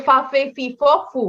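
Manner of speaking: "ফা, ফে, ফি, ফ, ফু" with f, not p; airy f on each syllable; correct F sound. Shown as a demonstration. Teacher correcting the P slip, correct F sound. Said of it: "এটা হবে".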